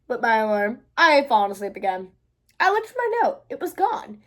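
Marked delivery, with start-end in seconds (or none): none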